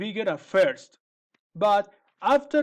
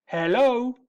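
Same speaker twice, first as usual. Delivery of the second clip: calling out; 4 semitones higher, 4 dB louder than usual. Acted. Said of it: "he called".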